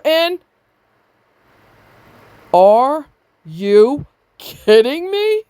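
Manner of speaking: disbelieving rise, slowed on the last words; are you KIDDING me, stretched out; incredulous. Real, not acted. Outraged rant at the husband.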